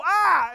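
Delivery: loud, high scream of fright; acted-out yelp of shock; frightened scream. Friends surprised on the dark beach, frightened scream. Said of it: "And we go".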